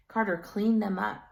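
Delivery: plain reading voice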